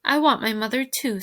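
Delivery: ordinary delivery, nothing marked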